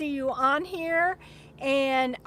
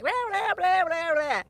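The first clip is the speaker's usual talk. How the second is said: caffeinated babble; 5 semitones higher than usual. Pretend. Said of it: "That's probably why I'm like".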